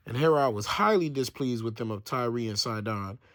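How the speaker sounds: usual speaking voice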